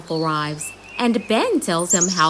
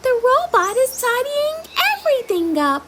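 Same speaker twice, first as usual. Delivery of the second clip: Holly cry. Acted. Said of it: "cries Holly".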